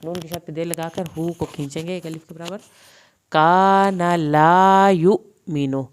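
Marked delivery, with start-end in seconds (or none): 3.3-5.8 slow, louder recited word; stretched tajweed recitation; Quran recitation. Shown as a demonstration. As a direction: chant it out slowly with a full heavy ṭā. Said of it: "نون کی زبر لمبی نہیں کرنی".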